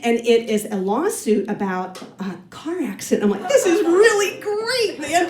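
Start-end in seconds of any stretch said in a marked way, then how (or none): 3.5-5.3 high, drawn-out delight; thrilled, high-pitched inner voice; retold excitement. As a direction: gush it slowly, pitched way up. Retold delight at jury case.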